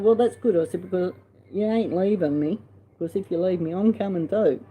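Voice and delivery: mock-stern loud retort to a dying sister; emphatic retort. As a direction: tell her she's not going anywhere. Retold reply to dying sister.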